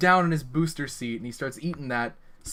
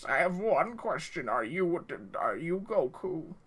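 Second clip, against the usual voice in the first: higher put-on voice, slowing on the question; Uncle Popius the Pope; 4 semitones higher than usual. Pope questioning the player about Goku, higher put-on role voice. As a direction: draw out the last question in character. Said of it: "The Pope really likes Dragon Ball Z".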